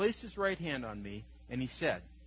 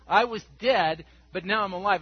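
triumphant proclamation; 4 semitones higher, 9 dB louder than usual. Acted. Preacher voicing the risen Christ to the congregation, triumphant. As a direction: proclaim it like a victory shout. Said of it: "I'll let you know who I am".

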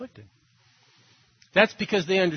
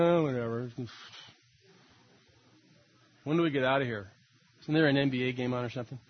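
bored; 6 semitones lower, 4 dB quieter, faster than usual. Retold or imitated. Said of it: "boredom is not an option".

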